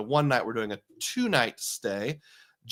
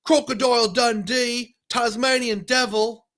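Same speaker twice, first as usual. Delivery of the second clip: mock Australian accent, pitched high; terrible Australian accent; 9 semitones higher, 5 dB louder than usual. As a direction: play a bad Australian accent for laughs. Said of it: "my terrible accent was well-founded".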